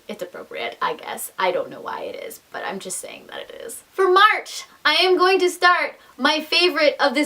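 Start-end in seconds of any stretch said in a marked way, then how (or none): 0.1-3.8 soft, half-whispered trailing aside; muttered aside. Real, not acted. To viewers, justifying her schedule in a soft mumbled aside. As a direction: mumble it to yourself, a bit sheepish. Sheepish aside about February choice.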